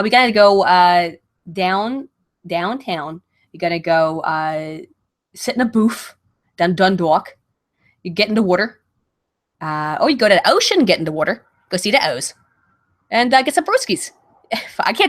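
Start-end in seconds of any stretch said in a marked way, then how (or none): 0.0-1.1 demonstrating a loud Baltimore accent. Shown as a demonstration. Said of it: "the Baltimore accent is like". 1.5-14.1 hammed-up Baltimore local accent; casual Baltimore accent demo. Shown as a demonstration. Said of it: "the Baltimore accent is like".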